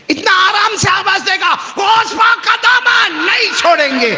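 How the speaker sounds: high, loud crowd chant; rallying shout. Acted. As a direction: lead the crowd in a roaring pledge.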